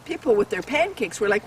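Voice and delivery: usual speaking voice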